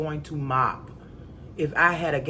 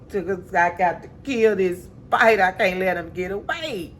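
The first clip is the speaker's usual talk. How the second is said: hammed-up wail, stretched at the end; mock crying; 3 semitones higher than usual. Woman boo-hooing over having to kill the spider, hammed-up crying. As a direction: imitate bawling in a put-on wail. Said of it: "I am crying my butt off".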